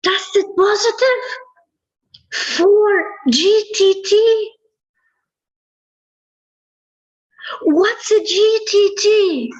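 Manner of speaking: high, incredulous questions; stunned, thrown right up in pitch; astonished disbelief. Retold or imitated. Wife hearing the test result, high-pitched disbelief. Teller reenacts her astonished reaction.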